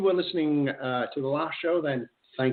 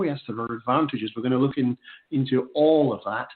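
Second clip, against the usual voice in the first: normal talking voice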